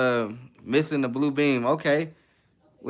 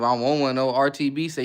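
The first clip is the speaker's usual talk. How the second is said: usual speaking voice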